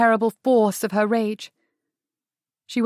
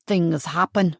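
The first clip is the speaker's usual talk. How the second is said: lower, measured and cool; terribly calm; 4 semitones lower, slower than usual. Acted. Adam answering Sarah's outburst, terribly calm. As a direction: stay unshaken, almost cold. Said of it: "in that terribly calm voice".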